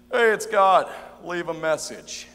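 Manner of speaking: loud, casual answering-machine greeting; friendly, fun God. Acted. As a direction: holler a chummy voicemail greeting. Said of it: "a little more friendly, something more fun".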